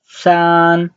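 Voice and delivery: San held high and level; first tone. Shown as a demonstration. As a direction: stretch San on one steady high note. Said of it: "the first tone".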